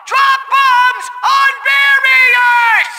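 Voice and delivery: high, furious yell, word by word; slow high-pitched villain tantrum; exasperated shout. Acted. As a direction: bellow the order very slowly. Repeats instruction, out of patience.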